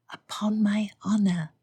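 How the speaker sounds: whispered. Acted. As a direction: breathe it into her ear. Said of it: "she whispered into his ear".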